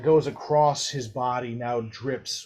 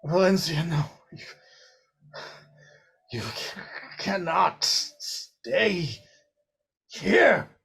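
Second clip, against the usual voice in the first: strained pleading; 4 semitones higher than usual. Acted. Alexander warning Valencia away, strained and pleading. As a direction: force the words out in fear. Alexander begs her to leave.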